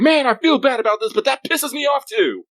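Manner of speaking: very high, loud comic whine; hammed-up guilty outburst, dragging out the end; mock anguished anger. Acting out a man guilty about yelling, yet angrily whining. His guilty angry reply, acted out.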